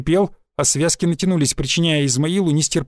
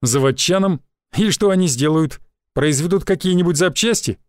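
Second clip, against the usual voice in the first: usual speaking voice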